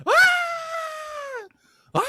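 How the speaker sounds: high mock baby wail; drawn-out imitation shriek; imitated baby scream. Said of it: "She's screaming".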